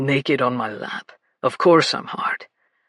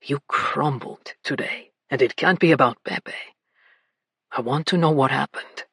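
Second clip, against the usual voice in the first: gentle tone; 8 semitones lower than usual. A narrator's reading. Vito gently asking what happened, softened voice. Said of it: "He gentled his tone".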